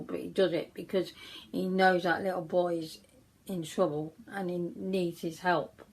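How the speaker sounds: ordinary talk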